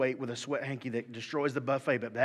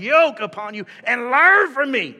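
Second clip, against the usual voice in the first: preaching, fading down; 6 semitones higher, 10 dB louder, slower than usual. Acted. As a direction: build it up then bring it down to a hush. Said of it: "I'm gonna read it like I believe it".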